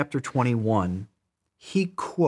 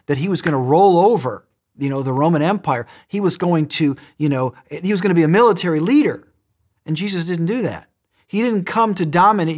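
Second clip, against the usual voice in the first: higher and louder, building to a ringing peak; building preaching; 6 semitones higher, 6 dB louder than usual. Real, not acted. Preacher to congregation, building to a loud, high peak. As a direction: drive it up like a rising sermon climax. Preaching climax, rising to full force.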